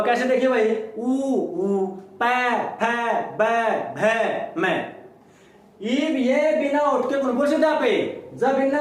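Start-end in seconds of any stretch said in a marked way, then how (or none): 1.0-4.9 slow, lips pressed on पै, फै, बै, भै; drawn-out lip syllables for the class; lip sounds demo. Shown as a demonstration. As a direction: spell out ऊ, पै, फै, बै, भै, मैं each slowly with the lips meeting. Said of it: "ओठों का इस्तेमाल होगा".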